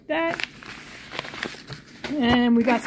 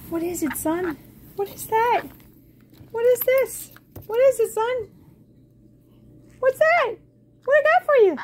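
much higher, louder sing-song questions; 11 semitones higher, 3 dB louder than usual. Real, not acted. Owner coos high questions at her parrot. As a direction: coo at the bird to excite him. Cooing questions to pet bird.

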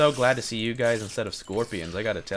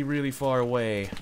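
ordinary talk, nothing marked

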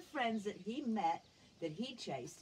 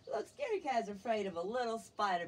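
ordinary delivery, nothing marked

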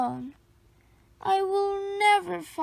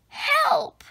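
cry for help; 11 semitones higher, 3 dB louder than usual. A narrator's reading. Thrown high in the air.

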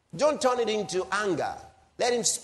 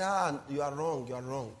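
softer, lower mocking brush-off; mock dismissive lawyer; 5 semitones lower, 7 dB quieter than usual. Preacher mocking a bad lawyer's reply, soft low mock brush-off. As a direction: murmur it back, mocking the lawyer. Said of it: "I say".

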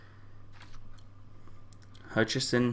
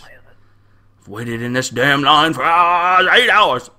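mock outrage; 2 semitones higher, 5 dB louder, much slower than usual. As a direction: build from a drawn-out gripe to a yell. Voices an angry waiting traveler.

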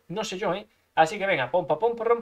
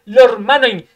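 normal talking voice

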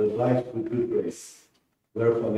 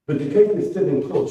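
a louder, higher blunt objection; woman pushing back at police; 7 semitones higher, 6 dB louder than usual. Acted. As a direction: cut in flatly and hard. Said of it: "she said bluntly".

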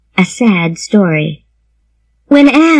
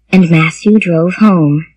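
usual speaking voice

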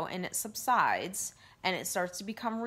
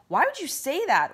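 sharp, higher and louder accusing question; demanding "Why would you say that?" loud and high, confronting the ego; challenging; 7 semitones higher, 6 dB louder than usual. Pretend. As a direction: confront it, fighting your own ego. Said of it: "instead of fighting the ego and challenging it and saying".

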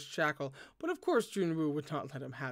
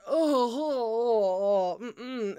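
creeped-out whimpers; 4 semitones higher, 6 dB louder, much slower than usual. Real, not acted. Creeped out by the fetus spirit.

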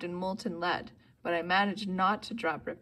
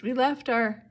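raised, alarmed blurt, cut off; sudden realization; 4 semitones higher, 6 dB louder than usual. A narrator's reading. Grover remembers the bags on the bus, alarmed. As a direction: blurt it out in dismay. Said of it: "Grover realized".

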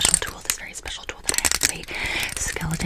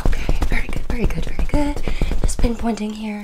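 fast aggressive asmr; 10 dB louder than usual. Acted. Intense close-up ASMR section.

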